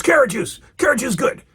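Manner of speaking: high, emphatic celebrity impression; Mister T. Mister T approving the juice for Hulk, emphatic impression. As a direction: declare it in short, pushy mock bursts. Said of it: "And he goes".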